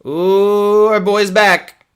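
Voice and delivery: high, loud, slow delighted ooh; excited. Real, not acted. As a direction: greet a favorite with glee. He's really going for facial expressions.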